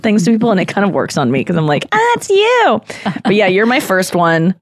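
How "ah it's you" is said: high, bright "ah, it's you" as if caught out; mock surprise. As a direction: play up a fake little discovery. Mock reaction to Instagram prompt.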